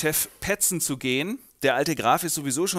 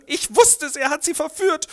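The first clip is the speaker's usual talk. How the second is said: high, loud outraged cry; 13 semitones higher, 6 dB louder than usual. Acted. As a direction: cry it out in shock. Renald realizes Gabi was seduced.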